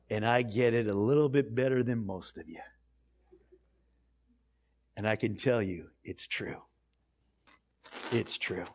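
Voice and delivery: low, hushed and sincere; quiet testimony. Real, not acted. Adopted preacher assures the congregation they belong, quiet and sincere. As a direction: drop right down, almost to nothing by the end.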